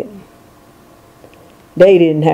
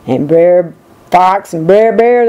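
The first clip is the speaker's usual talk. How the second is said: plain reading voice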